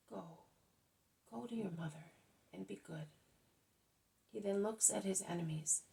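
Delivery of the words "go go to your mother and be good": softer, gentle and kind; a quiet tender blessing, much softer; tender farewell. A narrator's reading. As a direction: send him home like a loving father. Jesus caressing the man's head.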